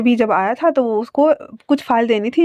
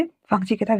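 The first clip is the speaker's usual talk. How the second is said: ordinary delivery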